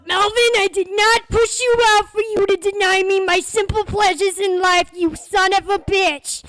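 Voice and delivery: high, very loud hammed-up tirade; full-volume mom rant, stretched at the end; furious mother shouting. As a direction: bellow at your son in outrage. Mother screams at son.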